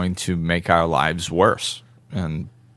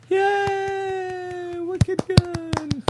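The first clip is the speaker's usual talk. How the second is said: put-on much higher squeaky cheer in a Boston accent; fake applause; much slower than usual. Host mimicking a New England crowd's fake applause. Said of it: "this is where that, like, fake applause comes in".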